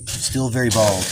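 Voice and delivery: put-on solemn storybook narration, American accent; storyteller voice. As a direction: spin an ancient myth, American accent. Mock-solemn creation tale.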